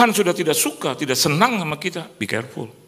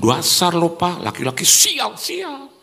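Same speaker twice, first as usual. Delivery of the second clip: hammed-up insult, pitched up at the end; mock scolding; 4 semitones higher, slower than usual. Wife cursing her husband, hammed-up scolding.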